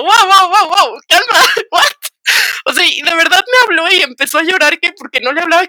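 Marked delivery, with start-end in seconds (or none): 0.0-1.8 stunned. Retold or imitated. Her shocked reply to drunk friend.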